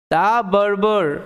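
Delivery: "the barber" drawn out word by word; slow careful reading. Shown as a demonstration. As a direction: read it out slowly for learners. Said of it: "আস্তে আস্তে ভেঙে ভেঙে উচ্চারণ".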